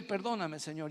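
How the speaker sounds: very soft, hushed prayer; humble plea. Retold or imitated. Repentant man begging the Lord, soft and hushed. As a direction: drop to a broken whisper of pleading.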